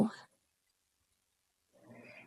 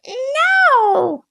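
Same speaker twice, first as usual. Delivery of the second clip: very high squawky hen; 13 semitones higher than usual. A narrator's reading. Hen answers the baby bird, a very high squawk. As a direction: cluck out a sharp no. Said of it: "said the hen".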